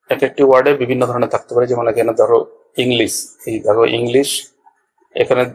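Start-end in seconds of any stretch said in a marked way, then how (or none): none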